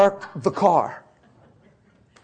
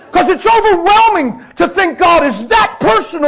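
real tearful shout, high and loud; crying out of control; 12 semitones higher, 10 dB louder than usual. Real, not acted. Preacher overwhelmed that God is personal, crying high and loud. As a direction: cry out, overwhelmed, rising to a shout. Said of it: "crying out of control".

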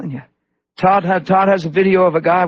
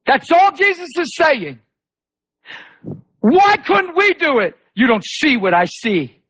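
high, stretched, forceful preacher cry; emphatic preaching; 9 semitones higher than usual. Acted. Preacher voicing Jesus answering the disciples, high emphatic delivery. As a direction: drive each word home, pitched high.

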